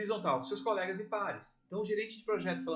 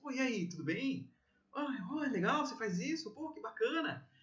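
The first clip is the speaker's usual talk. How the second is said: keen on a date; 4 semitones higher than usual. Shown as a demonstration. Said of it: "Como é que você fica na mesa".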